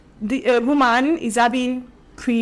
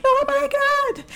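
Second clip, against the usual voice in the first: high, drawn-out mock wail of joy; acted happy crying; slower than usual. Speaker showing how she cries when happy, joyful wail. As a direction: play overwhelmed with happiness, slow and very high. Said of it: "I still cry because".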